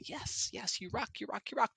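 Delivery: hushed cheering, breathy and high; encouraging whisper. Retold or imitated. Praising speakers softly across the room in a whisper. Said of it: "kinda going".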